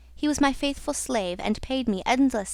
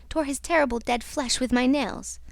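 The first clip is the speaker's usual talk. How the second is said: ordinary delivery, nothing marked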